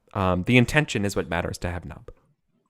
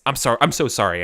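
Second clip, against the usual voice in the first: ordinary talk, nothing marked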